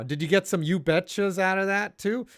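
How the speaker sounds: ordinary delivery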